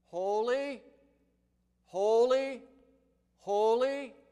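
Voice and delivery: loud, high ringing call of holy; echoing antiphonal proclamation. Acted. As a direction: cry out each holy like a seraph. Voicing seraphim calling antiphonally.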